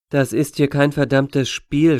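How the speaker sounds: plain reading voice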